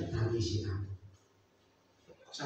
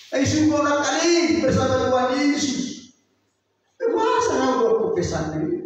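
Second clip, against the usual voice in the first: loud scolding; 12 semitones higher, 10 dB louder than usual. Acted. Revived pastor scolds family.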